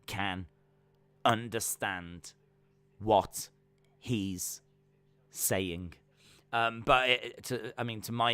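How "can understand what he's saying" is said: word by word with long gaps; slow, spaced-out demonstration; slowed right down. Shown as a demonstration. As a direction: pause after each word so it sinks in. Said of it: "slow it down, like, the words".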